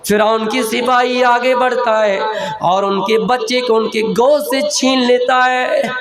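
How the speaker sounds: normal talking voice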